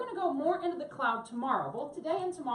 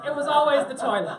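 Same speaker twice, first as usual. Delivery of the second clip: laughing; 9 dB louder than usual. Real, not acted. Punchline of the water story.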